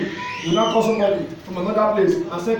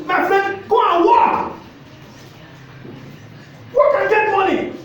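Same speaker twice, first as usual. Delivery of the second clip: urgent exhortation; 9 semitones higher, slower than usual. Real, not acted. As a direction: drive the order home. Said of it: "preacher exhorting the congregation".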